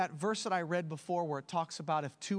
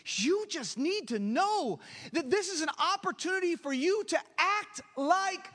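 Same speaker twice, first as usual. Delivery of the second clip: high, ringing preacher's call; preaching at full force; 6 semitones higher than usual. Acted. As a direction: preach it out to the congregation.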